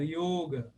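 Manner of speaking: yoga with a closed O; closed 'o' vowel in yoga. Shown as a demonstration. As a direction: say 'YOH-ga' for yoga. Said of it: "só tem o O fechado".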